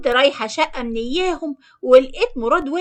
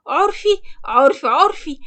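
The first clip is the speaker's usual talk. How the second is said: delighted; 3 semitones higher than usual. Acted. Woman accepting a marriage offer from her lover, mildly delighted. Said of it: "وأنا فرحت جدًا".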